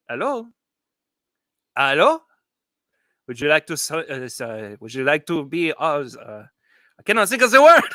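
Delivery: lightly put-on French accent. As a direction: play a hammed-up Frenchman coaxing the camera. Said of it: "It's my French accent".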